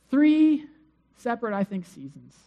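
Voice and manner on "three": "Three" punched much higher and louder; stress on three. Real, not acted. Preacher stressing Paul's three prayers, with strong stress on "Three". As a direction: hit the word "Three" hard. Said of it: "emphatic count in sermon".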